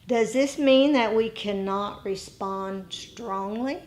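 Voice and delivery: ordinary talk, nothing marked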